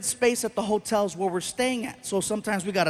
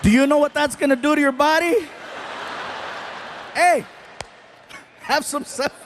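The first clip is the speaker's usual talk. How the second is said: mock scold; 3 semitones higher than usual. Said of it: "I gotta look at him and say".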